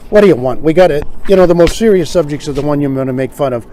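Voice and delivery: ordinary delivery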